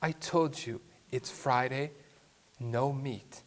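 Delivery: quiet, level, unhurried warning; very calm. Retold or imitated. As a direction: say it softly and calmly, no anger. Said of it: "very calmly he said to her".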